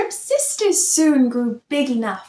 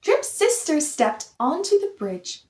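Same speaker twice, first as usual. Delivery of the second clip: ordinary delivery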